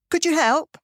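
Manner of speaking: high-pitched, questioning call; calling out for help. Retold or imitated. Andrew asks a passer-by for help, calling out. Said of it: "calls Andrew".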